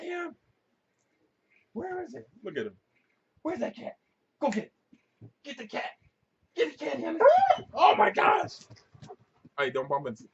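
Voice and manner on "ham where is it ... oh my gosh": much higher squeaky dog talk; excited pet voice. Real, not acted. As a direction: egg the dog on to chase. Hyping dog to find cat.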